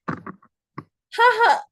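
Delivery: high "ha ha"; bright-pitched mock laugh in two beats; high staged laugh to lead clapping; demo laugh. Shown as a demonstration. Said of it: "first step is clapping".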